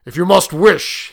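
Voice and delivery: loud, forceful warning; gruff. Acted. Soldier stopping his host's wish in alarm, gruffly. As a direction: speak up gruffly. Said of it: "he said gruffly".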